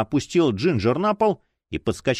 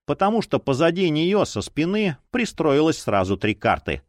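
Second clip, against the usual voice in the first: usual speaking voice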